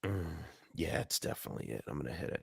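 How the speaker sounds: unvoiced, soft whisper. Real, not acted. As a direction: whisper it conspiratorially. About to hit the button.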